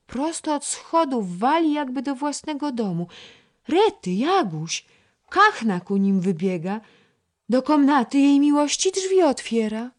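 put-on girl's eager whispered-loud amazement; excited gossip. A narrator's reading. Girl at the window telling her friend, excited and high.

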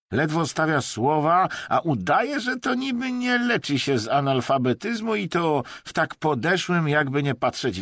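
scornful jeering. A narrator's reading. Vadim ridicules the hypocrite Emerson, scornfully. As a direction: jeer at him in a high voice.

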